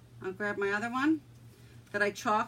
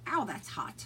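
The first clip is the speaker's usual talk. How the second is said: normal talking voice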